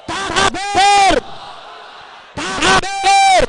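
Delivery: loud, high takbir cry; rallying shout. Real, not acted. Orator calls the rally to answer with takbir, rallying shout. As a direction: shout it out to rouse the whole crowd.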